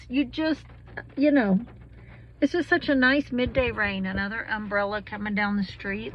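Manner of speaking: ordinary talk, nothing marked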